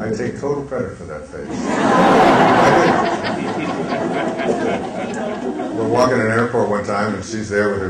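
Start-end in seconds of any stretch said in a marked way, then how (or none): 1.5-5.7 loud breathy laugh rising high; high airy laugh. Real, not acted. Father taking credit for the face, airy laugh. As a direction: let a big airy laugh rise.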